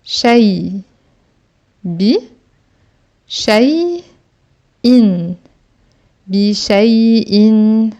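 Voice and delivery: Bi shay in drawn out very slowly, each syllable held; slow syllable-by-syllable reading. Shown as a demonstration. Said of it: "une syllabe avec soukoun".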